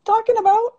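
squeaky high incredulous voice; mock denial. Retelling her reply to friends, high mock denial. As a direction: play dumb in a high squeak. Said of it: "I was like".

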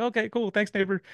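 bright upbeat thanks, voice jumps high; a high, eager "okay, cool"; chipper, high-pitched friendly reply. Retold or imitated. His own cheery reply.

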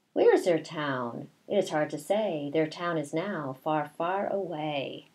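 slow, sing-song read-aloud; reading to children. A narrator's reading. Teacher reading a picture book to her class, slow sing-song. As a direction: read the rhyme slowly to the class.

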